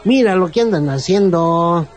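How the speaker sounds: lightly sung Mexico City singsong; Mexico City lilt. Retold or imitated. Man from Mexico City showing his accent, a light singsong. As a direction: stretch the vowels a little like a chilango. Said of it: "la tonadita de".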